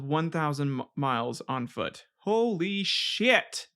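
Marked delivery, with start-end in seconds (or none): 2.2-3.7 slow, drawn-out astonished exclamation; amazed. Real, not acted. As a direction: stretch it out in awe. Reacting to thousand-mile walk.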